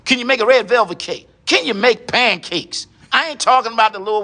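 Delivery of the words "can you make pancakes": usual speaking voice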